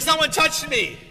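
loud, high mock-insistent call. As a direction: call it out over a crowd. Jesus insisting, acted by preacher.

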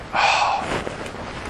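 long weary breathy sigh; exasperated sigh. Retold or imitated. Teacher fed up with a slow student, heavy sigh.

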